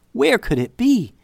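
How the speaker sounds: high, rising panic in the voice; panicked question. A narrator's reading. Narrator voicing a girl's sudden panic over the lost necklace.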